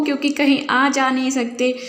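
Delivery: normal talking voice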